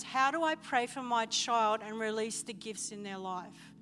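ordinary talk

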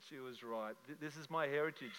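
quiet, stunned, as if it just hit him; hushed realisation. Retold or imitated. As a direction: breathe it out as the truth dawns. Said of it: "And I went".

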